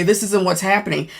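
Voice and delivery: ordinary talk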